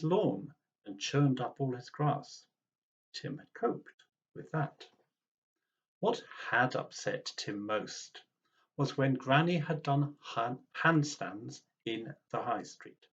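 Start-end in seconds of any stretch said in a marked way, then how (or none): none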